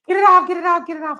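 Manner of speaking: very high frantic pleading; mock panic. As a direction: panic like the mask is smothering you. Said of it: "I'd be like".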